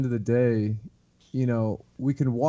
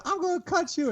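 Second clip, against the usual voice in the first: mock yell. Imitating Chip bickering with John, high-pitched mock yell. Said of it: "Chip's yelling".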